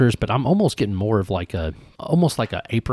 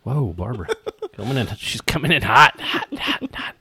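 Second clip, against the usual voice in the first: rising, breathy playful warning; hot hot hot, teasing; playful alarm; 3 semitones higher than usual. Acted. Cat rushing in, playful.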